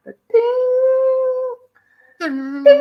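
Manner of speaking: high excited ding ding ding; imitated bell dings. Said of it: "there's that guitar".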